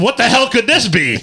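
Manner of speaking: mock-puzzled lift in pitch; mock wonder. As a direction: wonder aloud at a mystery treat. Retold thought on mystery treat.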